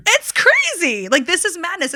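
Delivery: incredulous outburst. Real, not acted. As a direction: pitch jumps way up, slow and stretched on "It's crazy". Outraged about Thanksgiving.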